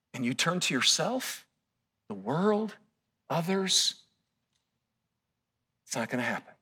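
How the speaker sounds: soft, quiet pleading; hushed preaching. Acted. Preacher speaking softly as God to the church. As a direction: drop to a near hush.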